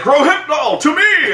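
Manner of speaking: high, loud mock cry; mock shout. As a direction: belt it out like a hammy hero. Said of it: "he's like".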